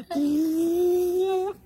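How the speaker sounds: 嘴巴模仿的噗声; 拟声放屁; 放屁声. A narrator's reading. 模仿响屁. (模仿放屁的"补")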